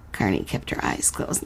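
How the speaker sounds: unhurried storyteller reading a fairy tale; story narration. A narrator's reading. Host reading the letter-writer's story to listeners, narrating. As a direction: read it like a bedtime tale.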